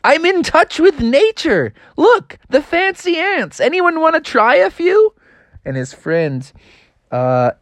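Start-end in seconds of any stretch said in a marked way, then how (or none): 0.0-5.1 high, eager little frog; high proud froggy voice; excited frog voice. Acted. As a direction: show off his discovery to friends. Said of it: "And he's like".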